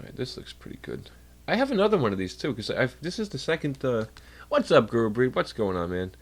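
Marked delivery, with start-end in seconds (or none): none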